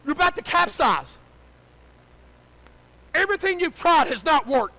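high, full-force preaching shout; yelled warning. Real, not acted. Pastor warning a sinking congregation, yelled. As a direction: yell to wake them up. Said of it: "put in your boat to yell at you".

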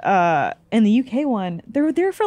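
normal talking voice

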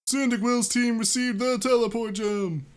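high, loud item announcement; high announcing voice. Acted. Reading game narration.

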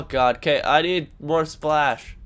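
ordinary talk, nothing marked

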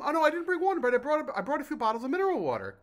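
high, mock-proud voice; proud party arrival. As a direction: announce your odd gift as if it's a treat. Acting out himself at party.

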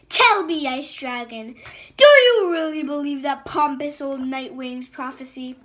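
loud scornful dragon queen; taunting. Acted. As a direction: ridicule his belief.

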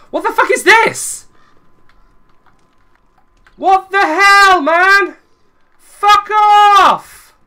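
high, loud yells with drawn-out words; real furious, high-pitched hollering; outraged shouting. Real, not acted. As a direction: scream in outrage at the wrecker. Giant wrecks his camp in game.

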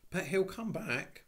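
put-on high, slow worried child; plaintive little girl's objection; mournful girl. A narrator's reading. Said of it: "said Anthea mournfully".

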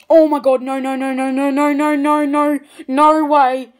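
loud, rapid string of stunned no's, slowing at the end; excited disbelief. Real, not acted. Collector stunned by a rare card pull, loud excited disbelief.

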